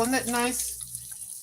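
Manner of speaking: soft and slow, touched; tender. Real, not acted. Mom tells viewers her son bought her the coat, tender and soft. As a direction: let it melt, gently grateful.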